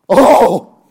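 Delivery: high, loud heartfelt cry; impassioned oh. Shown as a demonstration. Preacher demonstrating Paul's longing oh, loud cry. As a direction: pour your heart into the oh. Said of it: "I'm gonna show you this "oh".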